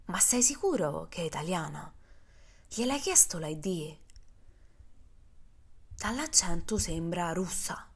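a hint of a wary soldier; suspicious friend. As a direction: murmur doubts to his friend. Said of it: "fa Nate".

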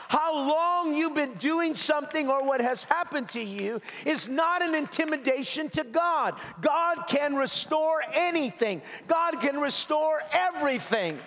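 high, ringing preacher's cry; fervent preaching. Acted. Pastor urging the hurting congregation, fervent preaching. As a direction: preach it out to lift the room.

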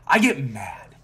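mad. Real, not acted. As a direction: blurt it out loudly, worked up.

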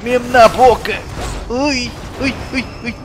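high-pitched excited reaction; worked-up gamer cries; excited alarm. Real, not acted. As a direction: exclaim high as the action hits. Excited reaction during game attack.

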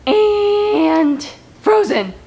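high-pitched eager Riley character voice. Acted. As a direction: play Riley, pitched well up. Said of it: "start with a couple of my own".

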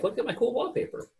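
high, excited show-off voice, pitched well up; playfully mock his own past pride in the wallpaper; mock proud boast. Before that, I'm like.

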